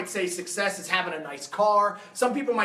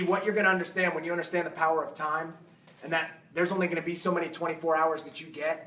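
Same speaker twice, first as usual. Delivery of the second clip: normal talking voice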